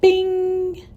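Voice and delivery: high, held "bing"; imitated elevator chime. A narrator's reading. Elevator arriving at her floor, high ringing chime. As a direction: ring out like an elevator bell. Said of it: "The elevator chimed".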